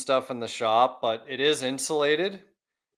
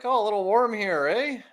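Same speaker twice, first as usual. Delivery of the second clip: higher-pitched, lightly teasing dad voice; dad impression; 10 semitones higher than usual. Son quoting his visiting dad on the heat, dad impression. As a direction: imitate dad with a rising "eh?". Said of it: "my dad's like".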